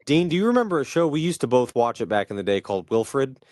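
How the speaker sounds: ordinary delivery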